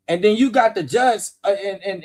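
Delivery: ordinary delivery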